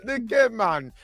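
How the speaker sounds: phony Jamaican street-seller pitch; fake Jamaican accent. Shop owner selling tourists goods, fake Jamaican accent. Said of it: "let's hear it".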